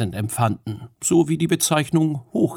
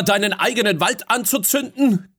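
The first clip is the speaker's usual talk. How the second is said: higher, raised challenging call; Fionn calling out; 11 semitones higher, 4 dB louder than usual. Acted. Fionn challenges the dark queen, raised voice.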